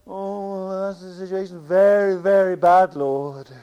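mock gloomy prayer, droning on one note; downcast prayer. Preacher mimicking people at a prayer meeting, downcast droning. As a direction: imitate a doleful prayer, leaning loudly on "very bad". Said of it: "you get this sort of down".

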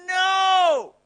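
high, loud, piercing "No!"; animal scream. Retold or imitated. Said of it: "like an animal screaming".

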